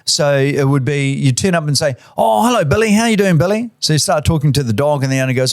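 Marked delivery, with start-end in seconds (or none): 2.2-3.7 cooing at a dog. Pretend. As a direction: coo at the dog like an old friend. Greeting the vendor's dog.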